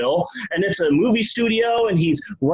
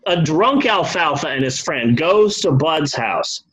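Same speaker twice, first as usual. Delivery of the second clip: ordinary delivery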